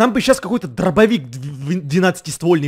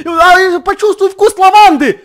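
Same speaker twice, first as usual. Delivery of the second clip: mock sales pitch; 14 semitones higher, 12 dB louder, slower than usual. Mocking zombie sales pitch.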